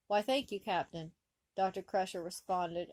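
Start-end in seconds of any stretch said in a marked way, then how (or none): none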